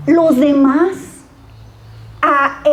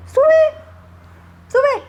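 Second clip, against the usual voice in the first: mocking prophet; 12 semitones higher than usual. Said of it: "cuando Micaías le profetiza y le dice".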